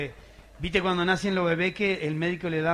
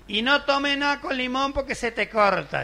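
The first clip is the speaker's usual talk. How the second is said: higher, slow, lightly voiced old grandma; hammed-up granny warning; a hint of a nagging grandmother; fussy grandma; 8 semitones higher, 4 dB louder than usual. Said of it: "decía".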